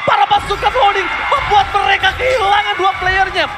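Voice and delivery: fast excited caster call; fighting game announcer. Acted. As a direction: call the play fast and high. Hyped play-by-play call.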